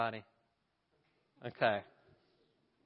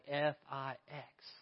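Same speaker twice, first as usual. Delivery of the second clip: patient spelling; 7 dB quieter, slower than usual. Acted. Operator spells a word.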